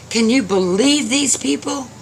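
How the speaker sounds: high-pitched indignant exclamation; angry disbelief. A narrator's reading. Old man to robed guest, angrily incredulous. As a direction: exclaim in outrage, voice shot up high. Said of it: "he was so angry".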